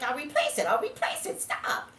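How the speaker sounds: impatient protest. Retold or imitated. Retold impatient protest to sister.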